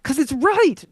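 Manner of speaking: high, loud "'Cause it's right"; mock-defiant comeback; indignant retort. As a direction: fire back, sure you're right. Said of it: "And I'm like".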